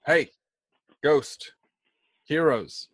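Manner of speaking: half-hearted shout in short bursts. Acted. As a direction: call out mildly, word by word. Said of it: "I'm gonna shout".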